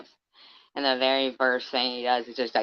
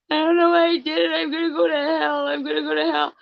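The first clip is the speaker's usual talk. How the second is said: high, fast, mock-sobbing plea; mock weepy killer; 13 semitones higher, 4 dB louder, faster than usual. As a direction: imitate the crying killer, high-pitched and whiny. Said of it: "that weepy, weepy voice killer".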